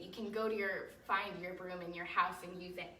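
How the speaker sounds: ordinary talk, nothing marked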